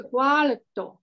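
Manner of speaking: cuarto said slowly, r right after the a; teacher sets cuarto against cuatro for a student; careful cuarto. Shown as a demonstration. Slow model of cuarto. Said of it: "不一样".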